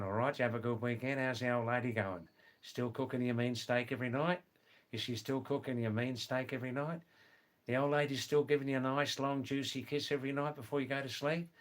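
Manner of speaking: fast, broad Aussie banter; rapid-fire Australian slang, cheeky and matey; broad Australian English. Shown as a demonstration. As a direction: rattle it off in thick Australian. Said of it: "pure, broad, rapid Australian English".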